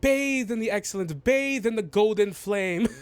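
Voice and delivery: high, pompous proclamation; theatrical, self-adoring declaiming; grandiose halfling dignitary. Acted. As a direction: bask aloud in your own glory. Halfling demands admiration.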